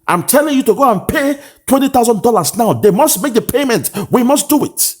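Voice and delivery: very high, pushy voice giving the order to pay; high-pitched impression of a committee member demanding payment; insistent mock quote. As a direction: play the official who insists the money is there. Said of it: "And who else is saying".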